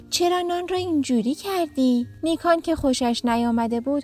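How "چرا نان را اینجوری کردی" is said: higher-pitched scolding question; mildly cross, pitched up; frowning reproach. A narrator's reading. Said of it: "نیکو با اخم گفت". (با اخم و سرزنش)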